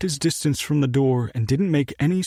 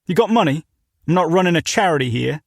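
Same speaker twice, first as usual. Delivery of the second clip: put-on British accent; 4 semitones higher, 4 dB louder, faster than usual. British owner demanding.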